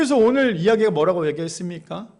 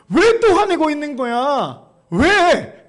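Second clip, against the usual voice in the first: slow question rising to a higher, louder why; reproachful demand; 4 semitones higher, 4 dB louder, much slower than usual. Acted. Preacher voices God questioning Jonah, reproachful demand. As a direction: press him, stretching the words.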